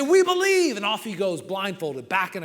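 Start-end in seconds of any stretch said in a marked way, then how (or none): 0.0-0.8 high, loud cheer of faith; crowd shout. Acted. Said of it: "And they shout".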